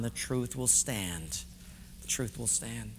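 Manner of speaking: low, soft and slow; hushed, grave conviction; solemn assurance. Real, not acted. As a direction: let it settle almost to a murmur.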